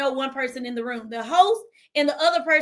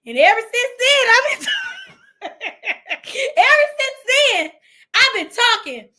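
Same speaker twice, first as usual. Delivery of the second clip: much higher and louder, breaking into a laugh; excited; 7 semitones higher, 6 dB louder than usual. Real, not acted. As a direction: gush it with a squeal. Excited, laughing retelling of the change.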